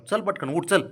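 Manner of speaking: ordinary delivery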